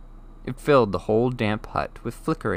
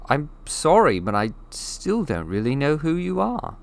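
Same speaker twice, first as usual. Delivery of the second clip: put-on a lightly higher, timid boy's voice; polite boy; 7 semitones higher than usual. Harry politely asks the giant who he is. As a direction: ask carefully, not wanting to offend.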